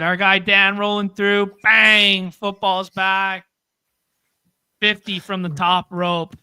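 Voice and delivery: loud hyped ringside call; wrestling commentator. Pretend. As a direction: bellow it like a wrestling announcer. Hyping a donation like wrestling.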